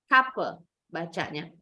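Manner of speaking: ordinary delivery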